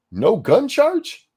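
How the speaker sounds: high slow disbelieving question; incredulous. Real, not acted. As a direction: stretch it out in disbelief. Incredulous at the news.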